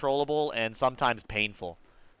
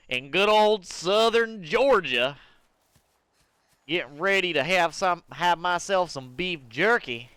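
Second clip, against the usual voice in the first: slow, higher hammed-up Southern drawl; mock Southern accent; 5 semitones higher than usual. Streamer mocking Georgia country talk, Southern accent. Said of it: "making fun of the Southern accent".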